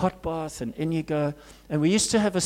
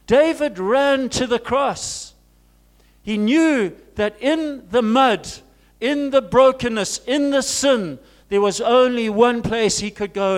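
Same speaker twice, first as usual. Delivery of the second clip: loud, raised preaching voice; fervent preaching; 7 semitones higher, 7 dB louder than usual. Acted. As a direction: preach it out with full force. Preaching at full force.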